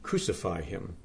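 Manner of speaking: quiet, understated crowd echo; hushed outcry. A narrator's reading. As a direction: let the repeated cry drop almost to a murmur. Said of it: "They cried out".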